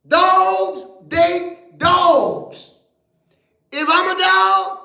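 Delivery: high shouted preacher's call, some words drawn out; full-force preaching. Acted. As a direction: whip the crowd up, stretching the key lines.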